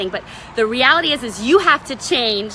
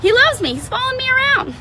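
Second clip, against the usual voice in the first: high, gushing mock brag; giddy bragging; 11 semitones higher than usual. Wife bragging that her husband follows her around, high and giddy. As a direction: gush like a smitten wife showing off. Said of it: "to like".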